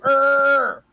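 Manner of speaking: "Er" as a long, high R sound; a loud, drawn-out "Er"; rhotic R. Shown as a demonstration. Showing how Irish people can do it, rhotic "Er". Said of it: "that can go".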